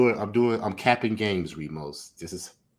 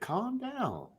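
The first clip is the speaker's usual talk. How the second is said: condescending player; 14 semitones higher, 9 dB quieter than usual. Retold or imitated. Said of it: "Put the woman in check".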